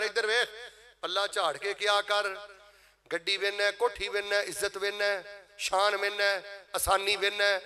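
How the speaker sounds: usual speaking voice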